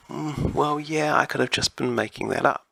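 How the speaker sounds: high-pitched musing voice of a doubter; sceptical people. Retold or imitated. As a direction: imitate people conceding doubt, pitched well up. Voicing doubtful people afterwards.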